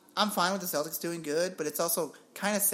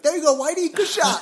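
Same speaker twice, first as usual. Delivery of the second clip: teasing praise; 11 semitones higher, 11 dB louder than usual. Retold or imitated. Teasing a guy named Whitey.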